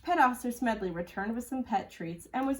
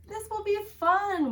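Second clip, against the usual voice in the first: much higher and louder, slowed and eager; pep up the dog with big cheer; Billy cheerfully reassures Walter in his lifeboat; excited child; 12 semitones higher, 6 dB louder, slower than usual. A narrator's reading.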